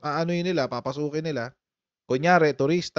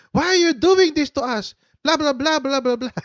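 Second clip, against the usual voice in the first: high mock wailing protest; imitating shouting woman; 9 semitones higher than usual. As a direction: ham up her shrill complaint. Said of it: "Chinese na nagsisisigaw". (panggagaya sa sumisigaw na babae)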